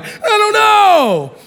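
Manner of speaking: hammed-up clueless crowd member; dumb mob voice. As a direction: play the clueless rioter.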